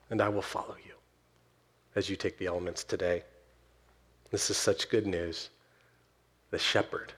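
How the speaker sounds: low, soft invitation to communion; hushed pastoral closing. Real, not acted. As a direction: gently lead the congregation toward the table, leaving pauses.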